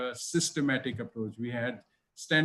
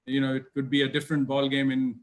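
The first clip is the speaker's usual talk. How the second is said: ordinary delivery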